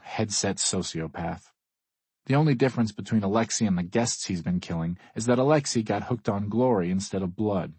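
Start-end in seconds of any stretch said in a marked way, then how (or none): none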